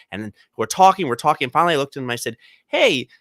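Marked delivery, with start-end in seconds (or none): 2.7-3.1 high, sweet therapist voice, coaxing a patient; therapy voice. Retold or imitated. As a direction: gently coax like a patient therapist. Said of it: "that's my therapy voice".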